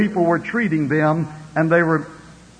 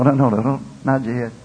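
normal talking voice

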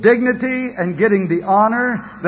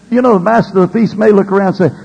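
normal talking voice

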